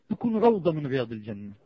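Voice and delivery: ordinary talk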